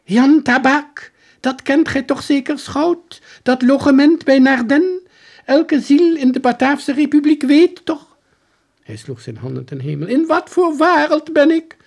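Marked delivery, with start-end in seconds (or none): 0.0-1.1 high, loud and slow, baffled; soldier voice. Acted. Soldier baffled the detective doesn't know, slow and high. 1.4-8.1 high, loud, incredulous; soldier voice. Acted. Soldier insisting to the detective, high and incredulous. 10.1-11.8 high, loud, incredulous; soldier voice. Acted. Soldier insisting to the detective, high and incredulous.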